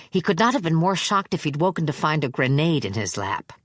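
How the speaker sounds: ordinary delivery, nothing marked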